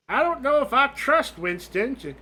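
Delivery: put-on high, swooping old-lady voice; old woman ghost. Old woman gossiping with Ethel, high and doubtful. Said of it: "you got these two old women".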